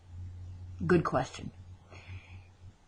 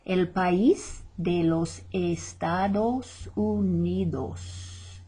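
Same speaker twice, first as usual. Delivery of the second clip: El país de los Estados Unidos drawn out slowly; slow repeat; slower than usual. Shown as a demonstration. Teacher repeats the phrase slowly after asking students to guess. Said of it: "guess what that is".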